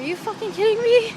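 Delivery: high, loud outraged disbelief; loud, high-pitched are-you-kidding-me. Retold or imitated. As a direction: shriek it like you can't believe the news. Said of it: "and I was like".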